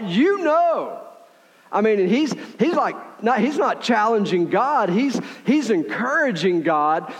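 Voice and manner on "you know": high, drawn-out confident "You know"; swaggering. Retold or imitated. Ezekiel answering the Lord with swagger. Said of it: "he's got swagger".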